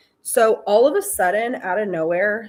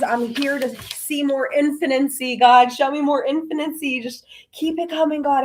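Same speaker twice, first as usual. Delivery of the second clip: acted-out impatient begging, pitched up; eager demanding plea; 4 semitones higher, 4 dB louder than usual. Begging God to reveal the other half, acted eager and insistent. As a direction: build from a big opening demand into insistent pleading.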